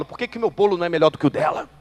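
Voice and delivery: loud, rapid indignant complaint; heated mock grievance; acted envious anger. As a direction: blurt it out fast, jealous and cross. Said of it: "fico com raiva e falo".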